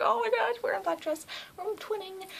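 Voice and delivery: very high girly fangirl squeal, retold; excited squeal. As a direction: mimic giddy delight way up high. Acted excited outburst about matching dresses.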